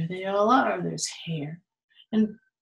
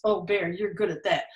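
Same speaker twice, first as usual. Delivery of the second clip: usual speaking voice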